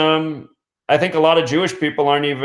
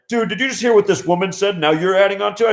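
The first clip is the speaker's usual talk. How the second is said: higher-pitched mock indignation; mildly outraged, raised pitch; retold angry reproach; 6 semitones higher than usual. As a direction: imitate an angry passenger scolding the driver. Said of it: "this guy just got so angry".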